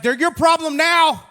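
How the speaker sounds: high, loud snapping handoff; exasperated outburst. Retold or imitated. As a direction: fling it over your shoulder, fed up. Wife hands off kids, storming off.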